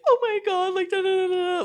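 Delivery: high, loud mock-weepy wail; fake crying. Friend overcome at the counter, exaggerated fake-crying squeal. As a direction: squeal as if crying, but not really. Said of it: "kind of, like, crying, but, like, not really".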